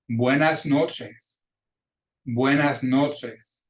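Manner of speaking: buenas noches very slow, falling at the end; model pronunciation. Shown as a demonstration. Teacher pronouncing words for students to repeat, buenas noches as a slow model. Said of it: "gonna pronounce those words for you".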